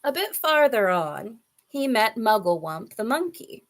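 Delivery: ordinary delivery, nothing marked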